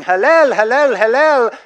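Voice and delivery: high loud repeated shouts; joyful yell. Retold or imitated. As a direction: call out in celebration. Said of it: "they're yelling".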